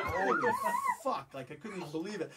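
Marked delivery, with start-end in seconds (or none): 0.0-1.3 amazed holy fuck. Retold or imitated. Soldiers waving from platform.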